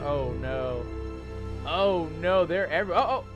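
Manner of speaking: usual speaking voice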